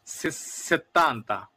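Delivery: সেত্তান্তা drawn out slowly with a double t; slow Italian settanta. Shown as a demonstration. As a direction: spell out সেত্তান্তা syllable by syllable, slowly. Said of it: "যেগুলোর উচ্চারণই আলাদা". (ধীরে ধীরে সেত্তান্তা উচ্চারণ)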